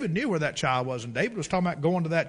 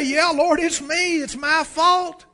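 hint of a penitent prayer; 11 semitones higher, 6 dB louder, slower than usual. Modelled confession prayer.

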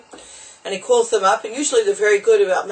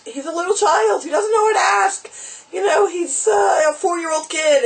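cutesy high voice; 9 semitones higher than usual. Real, not acted. Speaker describing a sweet four-year-old child, cutesy high voice.

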